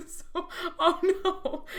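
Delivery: helpless real laughter, pitch climbing high; breaking up laughing. Real, not acted. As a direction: lose it mid-line and laugh through the words. Reader breaks up while reading.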